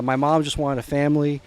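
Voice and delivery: usual speaking voice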